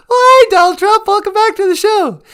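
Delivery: a chirpy fake DJ picking up the phone; cheerful host answering a caller on air, squeaky and loud; radio host voice. Host greets caller in put-on voice.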